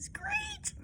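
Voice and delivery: very high breathy screech of a bird; imitating a great bird's cry, hushed; whispered bird screech. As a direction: squeeze out a tiny whispered screech. Kittens imagine a screeching bird.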